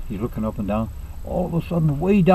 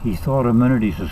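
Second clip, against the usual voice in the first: usual speaking voice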